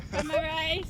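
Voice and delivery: lightly voiced hawker pitch; street vendor call. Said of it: "What did you say?".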